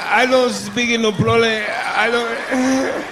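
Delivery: high, slow broken-English voice, straining for words; strained accent. Retold or imitated. A confused non-English speaker answering, high and slow. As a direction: sound like you're stuck mid-word. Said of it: "a look and a sound coming out of their face".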